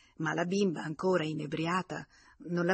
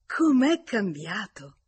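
loud, higher and drawn out; stretched-out, lifted cry of admiration; admiring exclamation; 4 semitones higher, 9 dB louder, slower than usual. A narrator's reading. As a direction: exclaim it slowly, marvelling at the visitor. Hostess exclaims at visitor's change.

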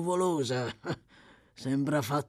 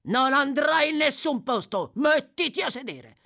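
loud, high-pitched order; domineering shout; angry command; 10 semitones higher, 6 dB louder than usual. Acted. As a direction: forbid it and order her down. Sykes angrily forbids Nancy to leave.

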